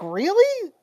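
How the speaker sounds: surprised. Retold or imitated. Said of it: "And I was like".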